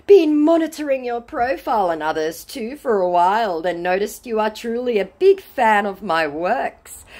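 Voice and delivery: high put-on voice for a scammer; scammer's voice. Scammer claiming to be a singer to a fan, high put-on voice. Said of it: "To which he responds".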